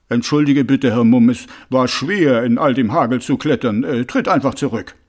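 a hint of a lumbering troll calling down; troll calling politely from the roof; lightly voiced troll. A narrator's reading. Troll Detritus apologizes from rooftop.

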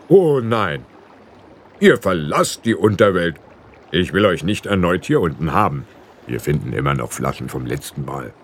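a stern ferryman putting his foot down; lightly voiced ferryman. A narrator's reading.

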